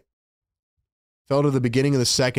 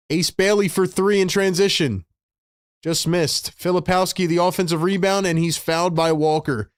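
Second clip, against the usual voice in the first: brisk, raised play-by-play call; live play-by-play; 6 semitones higher than usual. Acted. Fan streamer calling the Sixers game for chat, play-by-play. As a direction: call the action like a broadcaster.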